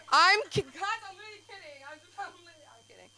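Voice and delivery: high, teary fake sob over kidding; hammed-up tearful whimper; mock crying. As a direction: pretend to break down, pleading. Mock crying after a joke falls flat.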